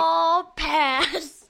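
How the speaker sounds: loud, emphatic verdict. Real, not acted. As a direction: call it out firmly. Playful verdict said twice.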